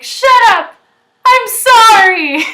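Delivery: high, loud yell straight into a drawn-out sorry; yelled apology. Retold or imitated. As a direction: shout it, then wail the apology comically. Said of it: "I'm, like, apologizing for saying".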